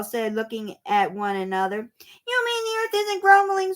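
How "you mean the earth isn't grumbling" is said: high squeaky question. A narrator's reading. Rooster and chicks asking the moles, squeaking high. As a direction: squeak it out in surprise. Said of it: "squeaked the loosey-goosey".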